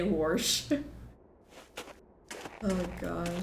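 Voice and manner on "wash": "warsh" with an r before the sh. Retold or imitated. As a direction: say "warsh" like a Kentucky teacher. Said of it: "I had a teacher who used to say".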